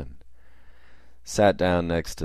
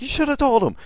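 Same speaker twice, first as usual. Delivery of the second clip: much higher and louder, a shrill-edged reproach; mock scolding cry; 5 dB louder, faster than usual. Storming woman rebukes the man, high mock scold. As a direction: snap it at him high and hard.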